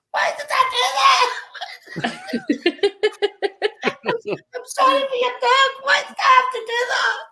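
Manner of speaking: high, laughing through the words; mortified laughing. Retold or imitated. Giggling apology to the grieving woman, high and laughing. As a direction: crack up while apologising. Retelling own mortified reaction.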